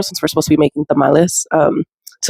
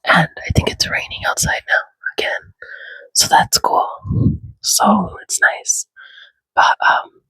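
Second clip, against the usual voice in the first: whispering. Real, not acted. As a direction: whisper it, people are asleep. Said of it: "I'm whispering because people are asleep in the house".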